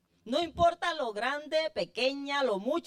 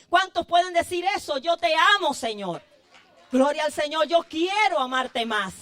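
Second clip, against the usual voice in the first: louder, impassioned call to the church; fervent preaching; 3 semitones higher, 6 dB louder than usual. Acted. Preacher urging his congregation, loud impassioned preaching. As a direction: lift the chorus words up with fervor.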